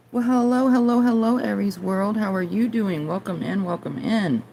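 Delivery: high, bright welcoming sing-song; cheerful greeting. Real, not acted. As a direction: greet them warmly and high.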